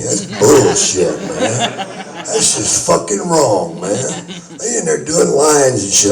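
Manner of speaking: real laughter through the words; chuckling, amused indignation; laughing. Real, not acted. Laughing while joking about the drugs.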